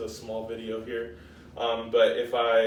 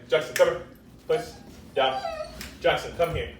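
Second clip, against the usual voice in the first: calling the dog; 5 semitones higher, 4 dB louder than usual. Real, not acted.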